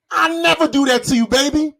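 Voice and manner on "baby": ordinary delivery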